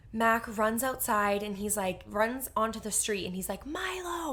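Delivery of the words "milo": retold yelling. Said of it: "like, yelling for him".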